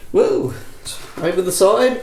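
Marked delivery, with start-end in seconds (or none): none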